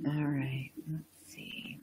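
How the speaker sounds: whispered under the breath; whispered aside. Real, not acted. Checking viewer comments mid-stream, whispered aside. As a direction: breathe it to yourself while scanning comments.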